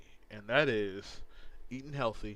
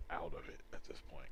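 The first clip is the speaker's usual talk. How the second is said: whispering; 7 semitones lower, 8 dB quieter, faster than usual. Real, not acted. Aside about being out of it.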